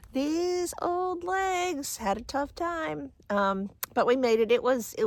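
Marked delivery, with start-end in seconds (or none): 0.1-3.1 high, slow, drawn-out moan; weary lament. Real, not acted. Hiker tells viewers about tired legs, weary lament. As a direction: stretch it high like a playful groan. Worn out after reaching the top.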